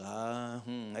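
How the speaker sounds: ordinary delivery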